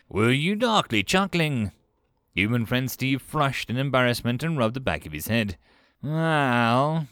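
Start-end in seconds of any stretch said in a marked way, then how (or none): 0.1-1.7 higher, insistent question; demanding. A narrator's reading. Kolch questions Steve about the sound, demanding. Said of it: "Quatulch demanded". 6.0-7.1 long, drawn-out hesitant word; said slowly. A narrator's reading. Steve begins an embarrassed explanation, slowly. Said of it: "he said slowly".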